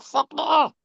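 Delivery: retold yelp of dismay over missing the shot; mock dismay. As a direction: squeal it like you just realised. Realising the camera was off.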